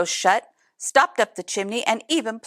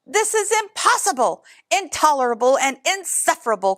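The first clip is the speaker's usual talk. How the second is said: angry outburst; 5 semitones higher than usual. A narrator's reading. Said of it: "cried the bear".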